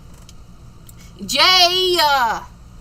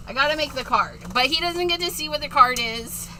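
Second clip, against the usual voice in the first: ordinary talk, nothing marked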